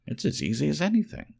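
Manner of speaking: high, quick boasting rabbit voice; furry rabbit boasting. A narrator's reading. Furry rabbit boasts to the velveteen rabbit, high and quick. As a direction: toss it off fast and high, showing off. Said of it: "said the furry rabbit".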